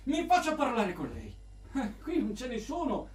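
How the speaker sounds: ordinary talk, nothing marked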